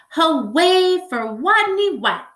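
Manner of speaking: loud, high cheer; cheering cry. Acted. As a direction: cheer for your hero. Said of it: "they cried".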